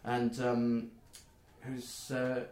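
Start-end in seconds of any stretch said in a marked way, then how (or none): none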